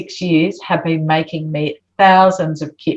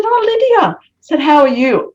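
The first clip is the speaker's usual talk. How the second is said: high, sweet and louder; gentle greeting; 10 semitones higher, 4 dB louder than usual. Retold or imitated. Greeting the girl who took the whiteboard, sweetly gentle. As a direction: coax her kindly, hiding suspicion. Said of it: "Be gentle".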